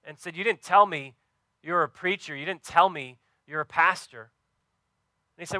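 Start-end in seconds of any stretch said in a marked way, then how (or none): 0.3-4.3 louder, higher complaint, retold with some heat; annoyed, frustrated. Diner owner to Tony after the prayer, loud annoyed rebuke. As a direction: complain loudly, half-annoyed. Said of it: "kind of annoyed, kind of frustrated".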